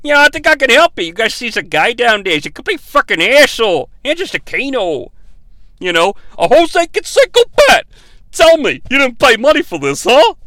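high, fast mock Bronx wiseguy; Bronx accent. Kabal pointing out Kano, hammed-up Bronx accent. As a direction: Peter Griffin's cousin from the Bronx. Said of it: "Peter Griffin's cousin from the Bronx".

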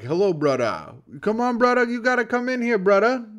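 high-pitched mock Hawaiian pidgin; Hawaiian accent. Bounty hunter greeting a Hawaiian guy, Hawaiian accent. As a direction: put on a coaxing Hawaiian lilt. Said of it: "he'd pick up a Hawaiian guy and he'll be like".

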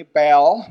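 Baal stretched slowly with a catch in the throat. Shown as a demonstration. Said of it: "there's a little catch in the throat".

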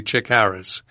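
ordinary delivery, nothing marked

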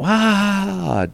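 long, high "ahh" of an audience; mimicked burst of audience reaction; imitated crowd roar. Imitates audience roaring at the line.

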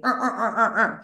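short repeated mock grunts; imitated grumbling. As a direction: grumble wordlessly like a disgruntled man. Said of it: "he left like".

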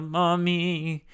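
put-on high, drawn-out child's cry; child calling mommy. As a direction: call out like a little kid. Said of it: "she's gonna be like".

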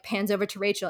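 ordinary delivery

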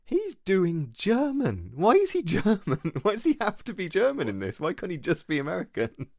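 baffled, pleading with the screen; exasperated disbelief. Retold or imitated. Retelling own baffled reaction.

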